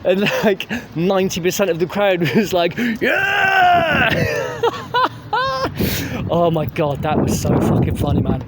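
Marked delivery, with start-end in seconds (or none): 3.0-4.1 high mock crowd "Yeah!"; imitated crowd cheer. 4.1-6.2 high laugh. Real, not acted. Laughing at crowd.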